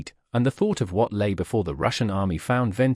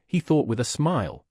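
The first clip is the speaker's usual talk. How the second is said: plain reading voice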